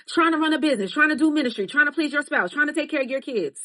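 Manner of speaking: preaching build. Acted. Telling women what life demands, fervent preaching. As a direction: pile up the burdens like a sermon.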